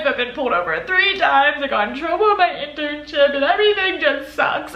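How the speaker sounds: half-laughing mock sob of the worst weeks; replaying her crying to the cop with a laugh; retold bawling. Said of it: "and then I started bawling".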